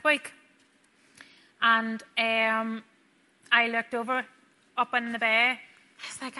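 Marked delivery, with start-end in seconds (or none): 5.6-6.4 breathy whisper. Real, not acted. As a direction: drop to a whisper. Unvoiced aside.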